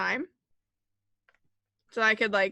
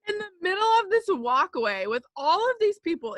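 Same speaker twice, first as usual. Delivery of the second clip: laughing; 9 semitones higher than usual. Real, not acted. Laughing while telling the fall.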